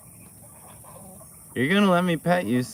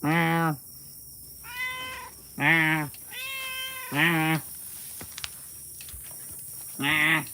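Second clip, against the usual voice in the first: imitated meow; 2 semitones higher, 4 dB quieter, much slower than usual. Meowing to call the cat.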